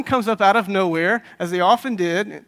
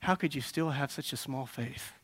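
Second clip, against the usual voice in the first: soft, low, gentle question; tender reproach, very softly spoken; loving; 4 semitones lower, 8 dB quieter than usual. Acted. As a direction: ask it lovingly, hushed and low. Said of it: "lovingly and said".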